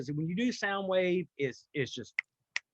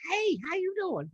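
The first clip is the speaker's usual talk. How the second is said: put-on Southern accent; 12 semitones higher than usual. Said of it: "the most southern voice I could".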